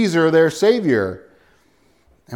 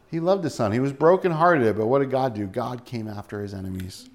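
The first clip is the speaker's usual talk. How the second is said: usual speaking voice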